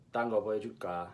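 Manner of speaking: Busan pronunciation. Shown as a demonstration. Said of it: "So that's".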